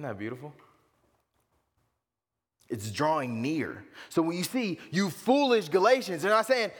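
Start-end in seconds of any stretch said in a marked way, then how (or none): 4.9-6.2 louder, higher scolding of the Galatians; Paul scolding the Galatians, raised and louder; mock rebuke. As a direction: call them foolish with a raised voice. Paul's rebuke voiced loudly.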